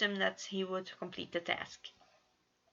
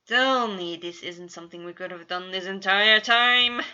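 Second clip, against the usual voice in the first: loud groaning protest; groaning complaint; 12 dB louder than usual. A narrator's reading. Virgil complains as he gets up, loud groan. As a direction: groan it out, louder. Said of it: "Virgil groaned".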